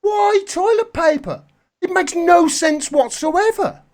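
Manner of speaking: high-pitched, baffled protest; exasperated disbelief. Real, not acted. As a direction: demand an answer, utterly incredulous. Baffled outburst at panic buying.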